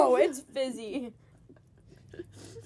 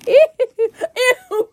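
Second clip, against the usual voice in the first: ordinary talk, nothing marked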